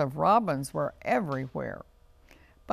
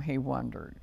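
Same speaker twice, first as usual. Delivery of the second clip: plain reading voice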